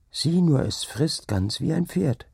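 put-on high, slowing boyish amazement; eager, high-voiced astonishment; excited boy. A narrator's reading. Boy points at the ghostly horse.

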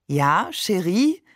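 slow, lilting, smitten reply; adoring. A narrator's reading. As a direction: draw it out as if gazing at a fairy. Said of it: "als wäre sie eine Erscheinung".

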